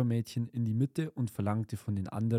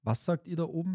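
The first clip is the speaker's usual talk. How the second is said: higher, eager call up to the doves; 7 semitones higher, faster than usual. A narrator's reading. Gerda calling up to the doves above, eager and higher. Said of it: "rief Gerda".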